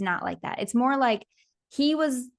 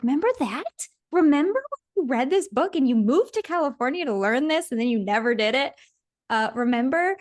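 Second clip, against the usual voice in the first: high, eager reminding; excited inner voice; 4 semitones higher than usual. Retold or imitated. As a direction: lit up, nudging yourself to remember. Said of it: "then I got excited".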